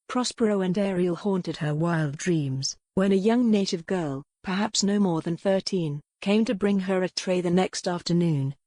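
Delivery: ordinary delivery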